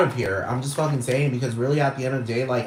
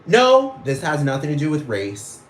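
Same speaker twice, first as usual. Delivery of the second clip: ordinary talk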